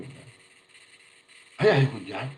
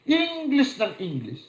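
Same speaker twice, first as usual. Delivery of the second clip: laughing mockery; 8 dB louder, slower than usual. Mocking, laughing.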